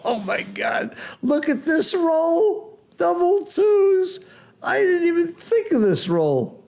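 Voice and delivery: high, drawn-out cry of astonishment; stunned commentator, pitch soaring; excited disbelief. Real, not acted. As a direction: call the shock roll in a stretched, high voice. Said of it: "I really was excited".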